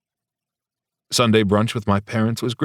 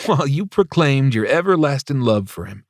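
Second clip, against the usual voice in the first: teasing; 6 semitones higher than usual. Acted. Tristan teasing continues.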